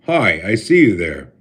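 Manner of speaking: ordinary delivery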